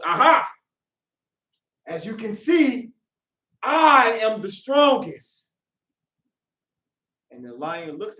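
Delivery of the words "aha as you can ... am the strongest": a loud "Aha!" then a slow, drawn-out boast; man gloating at the painting, loud and slow; triumphant gloat. Acted. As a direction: crow over the win, stretching every word.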